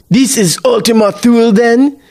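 put-on high-pitched, theatrical old-world villain roar; roared question. A narrator's reading. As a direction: demand it like a possessed seafarer. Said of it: "long enough to roar the question".

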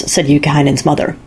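ordinary delivery, nothing marked

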